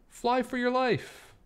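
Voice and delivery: begging the bug to fly away; urgent plea. Acted. Animals urge Baby Bug to fly.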